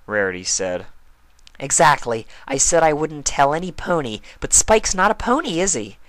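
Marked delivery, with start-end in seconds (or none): 1.6-6.0 higher, louder triumphant loophole; sly Pinkie. A narrator's reading. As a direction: proudly point out the trick.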